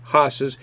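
horses in a Boston accent, no R, like hahses. Real, not acted. Beer reviewer catching his own Boston R-dropping in horses. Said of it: "I know I'm saying the Boston accent".

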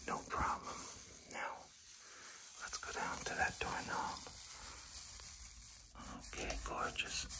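hushed whisper while working; soft, whispered instructions. Real, not acted. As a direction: talk yourself through the job in a whisper. Whispers along while painting.

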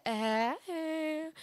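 high, drawn-out put-on hey; mimicked greeting. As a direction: imitate an awkward, stretched greeting. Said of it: "I said".

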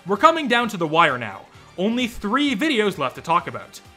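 ordinary delivery

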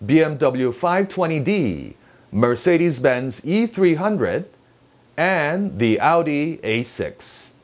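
slow, deliberate list with stress on each model name and number; measured newsreader list, "A six" falling at the end. Shown as a demonstration. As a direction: punch "five twenty D", "E three hundred", "A six". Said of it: "이렇게 강세를 주어서 나열을".

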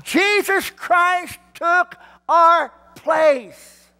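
slow triumphant proclamation. Real, not acted. Slow exultant preached line.

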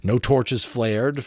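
plain reading voice

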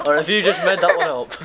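amused, laughing disbelief. Real, not acted. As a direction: tease him through laughter. Laughing at cousin's invented answer.

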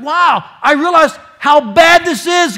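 loud, high, slowed plea; shouted prayer of a repenting sinner; repentant cry. Acted. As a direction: cry out for rescue.

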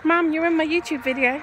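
high, loud call; calling out to mum. Real, not acted. Telling mum she's in the video, calling out brightly. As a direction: pitch it up to get mum's attention.

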